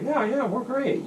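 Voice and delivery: high, slow, easygoing mom-and-pop voice; a light mock of a cheerful supporter. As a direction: play it like a friendly rally-goer. Voicing friendly Trump supporters.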